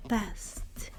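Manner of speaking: usual speaking voice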